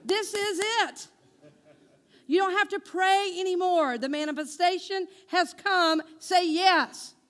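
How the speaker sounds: emphatic urging. Retold or imitated. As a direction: push her to just say yes. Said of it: "I said".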